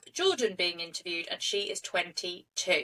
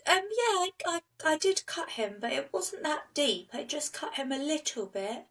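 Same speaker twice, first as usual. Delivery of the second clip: much higher, airy and dismissive, like it's nothing; her way of talking; 7 semitones higher than usual. Retold or imitated. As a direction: shrug it off in a small, high girlish voice. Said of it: "that's how she talks as well".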